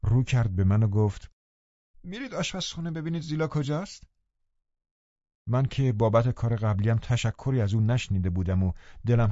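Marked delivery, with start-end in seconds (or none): 2.0-4.1 high, soft, complaining invalid; peevish sickly boy. A narrator's reading. Linton complaining to the visitors, high and peevish. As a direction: fret about the cold door.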